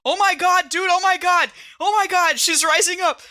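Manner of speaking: high, loud amazed cry; excited. Real, not acted. Player cries out as the doctor sits up, excited and loud. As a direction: shout with wide-eyed excitement.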